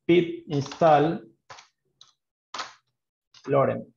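pip install drawn out very slowly, word by word; dictating a command at a crawl; slow dictation while typing. Shown as a demonstration. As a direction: spell out pip install slowly as you type it. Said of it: "Entonces voy a decir".

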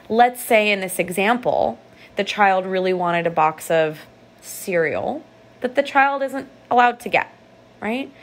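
ordinary talk, nothing marked

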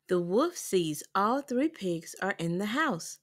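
ordinary delivery

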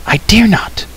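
high, hushed cry of refusal; fearful cry. A narrator's reading. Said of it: "cried Elenwy".

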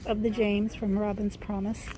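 normal talking voice

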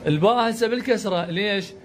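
ordinary delivery

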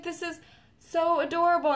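high-pitched imitated delight; retold adoring gush. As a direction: coo over a cute present. Voicing singer's delight at gift.

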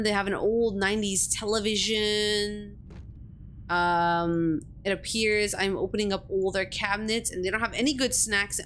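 ordinary delivery

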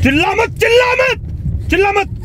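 sharp, high-pitched repeated order; angry command. Acted. Driver ordering passenger not to scream, angry.

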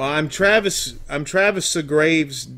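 usual speaking voice

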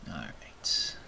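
whispered aside; hushed all right. Real, not acted. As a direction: drop to a breath of a voice.

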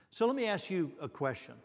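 usual speaking voice

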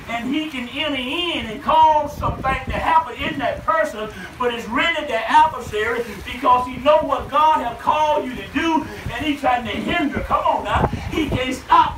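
normal talking voice

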